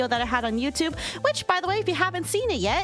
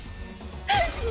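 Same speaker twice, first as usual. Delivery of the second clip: high giggle; 3 dB quieter than usual. Real, not acted. Laughing at chat joke.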